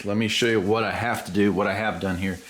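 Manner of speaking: ordinary talk, nothing marked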